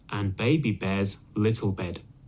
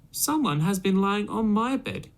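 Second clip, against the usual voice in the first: higher mama bear voice; middle-sized voice; 10 semitones higher than usual. Acted. Mother Bear seeing her pillow moved, higher mama voice. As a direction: raise the pitch into a mother bear. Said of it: "in a middle-sized voice".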